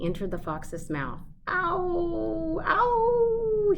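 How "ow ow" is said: high sharp yelps of pain; pained gasping ow. A narrator's reading. Fox in the dentist's chair yelping in pain. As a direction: yelp it out like a jab of pain. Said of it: "he gasped".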